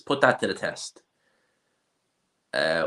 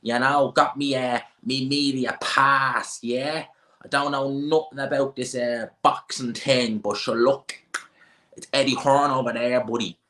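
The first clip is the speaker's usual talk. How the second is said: put-on higher, drawling Dublin wide-boy voice; mocking Dublin accent; 6 semitones higher, slower than usual. Streamer mimicking Dubliners at the press conference, mocking Dublin accent. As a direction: put on a thick Dublin accent, stretching the phrases. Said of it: "I don't even wanna be listening to that".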